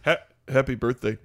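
low Eli Manning voice. Acted.

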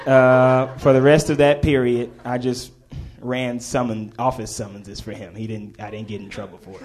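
ordinary talk, nothing marked

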